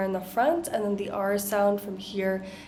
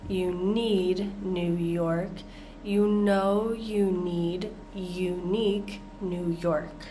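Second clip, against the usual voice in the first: careful teaching pace through the twister; slow, careful demo; slower than usual. Shown as a demonstration. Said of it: "Let's listen again".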